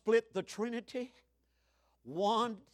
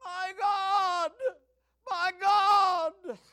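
high, louder wail of anguish; anguished cry; 10 semitones higher, 7 dB louder, much slower than usual. Acted. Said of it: "You can hear it".

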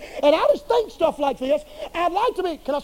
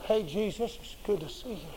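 stiff greeting to Jesus; 5 semitones lower, 11 dB quieter than usual. Retold or imitated. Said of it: "I can see him say".

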